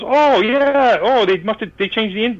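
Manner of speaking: high, animated retold exclamation; excited realization. Keith finding where the set was, excited. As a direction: burst out as it dawns on you.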